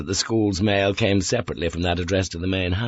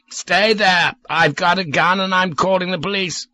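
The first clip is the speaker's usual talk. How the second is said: put-on slurred, raised drunk man's voice; drunken shout; 10 semitones higher than usual. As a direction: bellow the threat, drunk and wary. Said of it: "Peter's slurred voice shouted".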